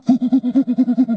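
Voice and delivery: loud burst of laughter; amused laughter at the unicorn suit. Acted.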